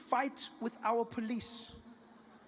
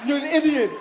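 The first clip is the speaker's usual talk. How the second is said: higher and louder, laughing through it; cackling mock shout of you stupid; laughing mock insult; 8 semitones higher, 11 dB louder than usual. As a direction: laugh as you call him an idiot. Laughing at his own mock rebuke.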